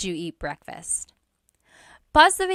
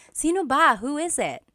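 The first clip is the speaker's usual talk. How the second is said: higher, insistent call to the door; acted emphatic question; 6 semitones higher than usual. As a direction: demand to know who is knocking, pitched up. Acted answer to hard door knocking.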